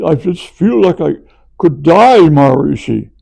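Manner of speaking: high, loud moaning whine; whiny complaint. Retold or imitated. Swedish course member moaning to Maharishi, high loud whine. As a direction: moan it like a martyr.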